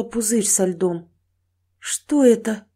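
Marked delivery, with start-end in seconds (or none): 1.8-2.7 mildly startled question; puzzled, weak surprise; surprised. Acted. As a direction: ask in bewilderment on discovering something. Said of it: "удивлённо спросила она".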